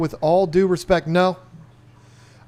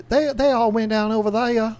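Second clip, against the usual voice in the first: put-on Southern belle accent; 8 semitones higher than usual. Imitating an aunt from Virginia, high Southern belle accent. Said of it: "that Southern Belle kinda accent".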